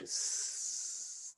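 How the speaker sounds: soft voiceless hiss; snake hiss. Acted. Snakes at the gates hissing as they part, soft hiss.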